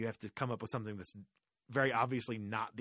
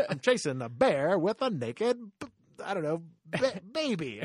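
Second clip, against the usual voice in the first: higher, slow talk-singing that breaks into laughter; a hammed-up camp reply; 7 semitones higher, 6 dB louder than usual. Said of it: "as [name], you had to respond".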